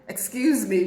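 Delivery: a hint of an American accent; mock American accent. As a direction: imitate the American stranger's accent. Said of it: "it was an American accent".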